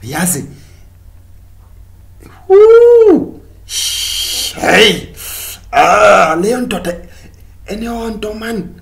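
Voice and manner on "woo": mock high squeal. Sudden squeal.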